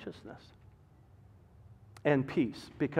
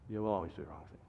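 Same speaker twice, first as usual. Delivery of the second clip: much lower and softer, weighty; grave low echo; 9 semitones lower, 10 dB quieter, much faster than usual. Acted. Pastor warning parents, dropping to a low hush. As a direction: let the warning sink in quietly.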